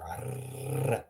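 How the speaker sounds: a long trilled R; rolled R. Shown as a demonstration. Teacher urging learners to roll the R, long trilled R. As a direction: hold the tongue roll on R. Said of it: "So do roll the R".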